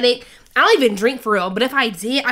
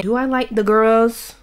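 ordinary talk, nothing marked

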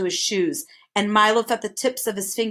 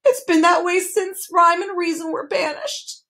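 high-pitched, mildly tearful complaint; sobbing lament; 9 semitones higher, 3 dB louder than usual. A narrator's reading. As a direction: wail it as a sorry lament. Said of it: "he sobbed".

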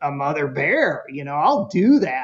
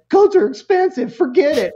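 very high, loud outburst; protesting kid; 10 semitones higher, 6 dB louder than usual. Retold or imitated. Son rejects Mom's money idea, protesting. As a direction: imitate a child's exasperated refusal.

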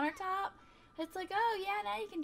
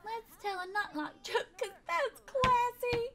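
mock classy; 6 semitones higher, 3 dB louder, slower than usual. Mock posh joke.

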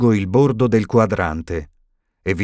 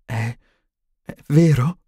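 hesitant stammer, rising higher on the question; 5 semitones higher than usual. A narrator's reading. Stunned witch asking headmaster if it is true, stammering. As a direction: trip on the first word, disbelieving. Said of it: "balbettò".

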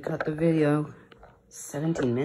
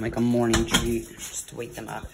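ordinary talk

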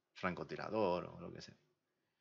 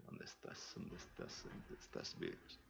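muttered whisper; 16 dB quieter, slower than usual. Real, not acted. Searching for the comment, muttering.

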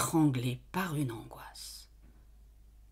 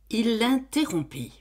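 ordinary delivery, nothing marked

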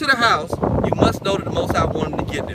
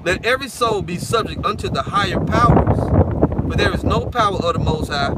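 scripture reading; 4 dB louder than usual. Acted. Reads scripture aloud.

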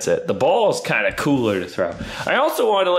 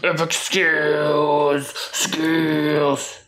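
drawn-out hype; much slower than usual. Pretend. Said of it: "showing off his epic skills".

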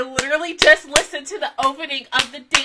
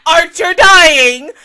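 slow, drawn-out, much louder and higher cry; anguished wail; 6 semitones higher, 15 dB louder, much slower than usual. Real, not acted. Reliving a beloved character's death for viewers, wailing. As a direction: stretch it out like a wounded howl.

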